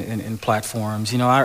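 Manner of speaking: ordinary delivery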